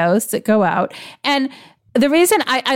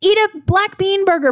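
indignant insistence; 10 semitones higher than usual. Real, not acted. Heated rant against fake meat.

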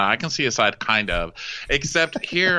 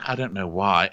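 ordinary talk

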